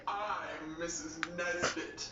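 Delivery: slow, low, lightly done hero voice; a hint of a dazed space ranger; Buzz Lightyear as Mrs Nesbit. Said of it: "when Buzz Lightyear was Miss Nesbit, and he was like".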